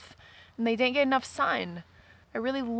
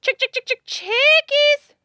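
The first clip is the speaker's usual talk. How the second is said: high, loud sing-song chick call; calling chickens; 10 dB louder, slower than usual. Real, not acted.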